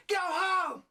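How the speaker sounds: very high, loud mock scolding shout; imitated mom's yell. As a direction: yell it high like a mother ordering kids off. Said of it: "someone yelling at them".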